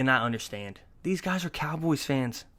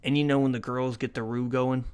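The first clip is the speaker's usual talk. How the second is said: ordinary talk, nothing marked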